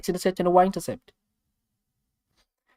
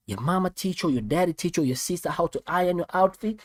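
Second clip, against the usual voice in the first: ordinary talk, nothing marked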